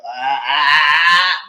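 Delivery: loud put-on groans of pain; badly faked pain wails; fake pained cries. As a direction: ham up the hurt leg. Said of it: "play the sympathy card".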